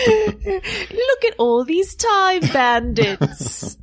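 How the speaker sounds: very slow, high British accent. Shown as a demonstration. Man redoing his British accent line, very slow. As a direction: stretch out Look at all these time bandits in English accent. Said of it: "I think that's a good British accent".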